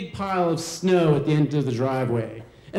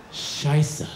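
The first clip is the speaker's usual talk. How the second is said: normal talking voice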